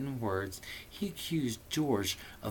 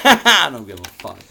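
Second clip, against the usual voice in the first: loud outburst; 6 semitones higher, 11 dB louder than usual. Real, not acted. Defiant joking outburst.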